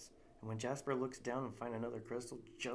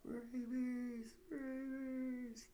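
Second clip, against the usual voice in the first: high panicked yell, fading; 14 semitones higher, 6 dB louder, much slower than usual. Acted. Narrator yelling at the bat swarm, panicked yell. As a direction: scream it as the bats come. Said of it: "I yell out".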